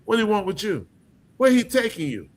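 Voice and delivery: suspicious dad firing off questions, pitched high; questioning father. Acted. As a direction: grill them like a protective father. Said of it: "the average father would have been questioning".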